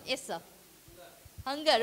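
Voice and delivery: normal talking voice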